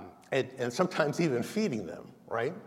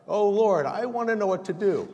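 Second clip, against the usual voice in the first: pleading prayer; 8 semitones higher, 5 dB louder than usual. Acted. Voicing a pleading believer.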